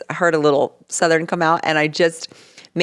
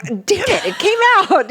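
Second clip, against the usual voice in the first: mock exasperation; 13 semitones higher than usual. Retold exasperation at own accent slipping.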